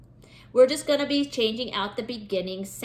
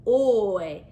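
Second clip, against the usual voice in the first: normal talking voice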